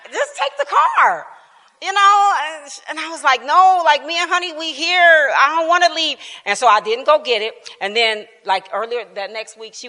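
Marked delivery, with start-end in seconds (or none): 0.1-1.2 very high mimicked teen plea; imitated teen exasperation. Retelling granddaughter's plea for the laugh, exasperated mimicry. 3.5-6.2 retold protest. Acts out refusing granddaughter's request.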